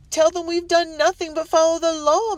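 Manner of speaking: high, loud, panicky plea; wife begging her husband, panic rising; rising shrill pitch. A narrator's reading. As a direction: voice climbing in panic. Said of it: "voice was rising to a shrill pitch".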